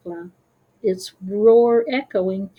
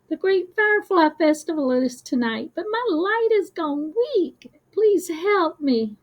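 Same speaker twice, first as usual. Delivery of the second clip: tiny firefly voice; 8 semitones higher than usual. A narrator's reading. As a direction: voice a small weak firefly begging for help. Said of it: "his voice barely a whisper".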